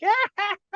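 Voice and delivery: high put-on monster cackle; high staccato jeering laugh; other head's laugh. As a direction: cackle along as the giddy second head. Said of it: "the other one's like".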